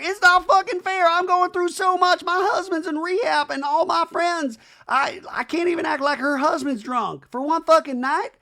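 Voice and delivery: very high, loud screeching rant; hammed-up hysterical yelling; mock meltdown. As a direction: scream it as an over-the-top tantrum. Imitating Brittany's screaming meltdown.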